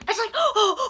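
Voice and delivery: excited gasp. Real, not acted.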